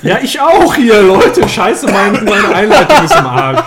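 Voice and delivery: high, loud laughing talk; laughing hard. Real, not acted. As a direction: laugh through every word. Laughing, intro is ruined.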